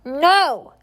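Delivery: high, loud refusal; a boy refusing to become a thief; loud high cry of refusal; shouted. A narrator's reading. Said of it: "Oliver shouted".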